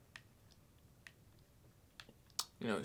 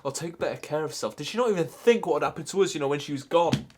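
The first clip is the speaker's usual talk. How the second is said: raised voice, hurt accusation; angry reproach; 2 semitones higher, 8 dB louder than usual. Acted. Grieving grandchild blaming Nan for not caring for herself, angry. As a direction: build into a loud, wounded reproach.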